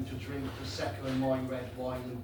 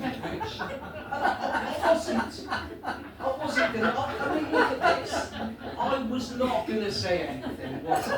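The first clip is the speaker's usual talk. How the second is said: giggling through the words, pitched up; trying to talk while cracking up; breaking into laughter mid-sentence; laughing; 7 semitones higher, 6 dB louder than usual. Real, not acted. Laughing at his own belly.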